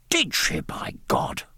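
put-on an old sailor astonished and delighted; Did she jumps up high, by God drops to a breath; surprised cry. A narrator's reading. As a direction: pitch leaps high, then breathes out the oath. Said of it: "cried the Admiral".

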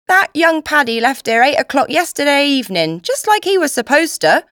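loud, high Cockney cook; proclaiming cook. A narrator's reading. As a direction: proclaim it loudly over the washing-up. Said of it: "the cook proclaimed".

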